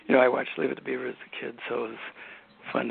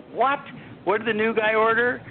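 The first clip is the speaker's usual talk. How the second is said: raised, carrying shout down the table; retold loud call; 9 semitones higher, 5 dB louder than usual. Director shouts across the dinner table at the new writer, loud and mock-incredulous. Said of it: "very loudly say".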